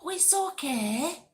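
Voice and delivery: normal talking voice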